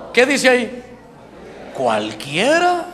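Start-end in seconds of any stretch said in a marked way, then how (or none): 1.7-2.9 "Cualquiera" drawn out very slowly; slow careful reading. Shown as a demonstration. Preacher showing the congregation how to read the Bible, very slowly. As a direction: read "Cualquiera" stretched syllable by syllable. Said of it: "hay que leerla bien despacito".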